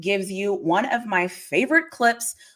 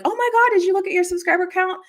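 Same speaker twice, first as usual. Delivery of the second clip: excited fans; 12 semitones higher than usual. Retold or imitated. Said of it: "call me and be like".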